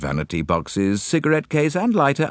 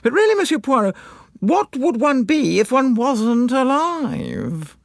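affected society wife. A narrator's reading. Wife challenging Poirot's remark, high affected voice.

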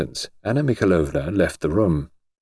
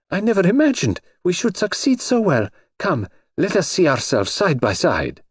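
cartoon princess; 11 semitones higher than usual. Grand Duchess delighted.

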